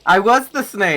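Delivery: loud, high burst of realization; real delighted yell, pitch leaping up; excited shout. Real, not acted. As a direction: cry it out, thrilled by the joke. Sudden delighted realization of joke.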